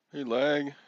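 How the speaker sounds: loud greeting. Real, not acted. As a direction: call out to a viewer arriving. Greeting chat viewer.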